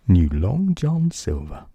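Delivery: usual speaking voice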